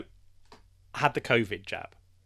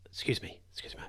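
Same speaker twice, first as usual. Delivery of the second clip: hushed, softer whispered excuse me; 9 dB quieter, faster than usual. Retold or imitated. Old woman whispering to receptionist in waiting room. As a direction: breathe it without voice, trying not to disturb. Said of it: "people are whispering one another".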